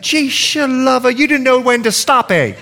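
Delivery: high-pitched Bahamian granny exclaiming; Bahamian accent. Retold or imitated. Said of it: "She's Bahamian, excuse the accent".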